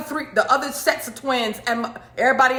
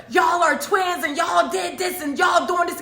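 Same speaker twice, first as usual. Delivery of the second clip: high, slow furious shout from Mom; angry mom yelling; 7 semitones higher than usual. Retold or imitated. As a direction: yell the accusation at both twins, slowly. Mom accusing the twins angrily.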